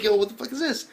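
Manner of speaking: high-pitched puzzled reaction; acted bafflement. Reviewer confused by one star versus three, acted bafflement.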